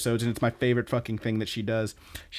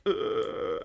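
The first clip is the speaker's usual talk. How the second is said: higher, voiceless mock strangled groan; imitated groan; 7 semitones higher, 3 dB quieter than usual. Boss reacting to her rebuke, imitated groan. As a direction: let out a stuck, airy noise of dismay. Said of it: "And he's like".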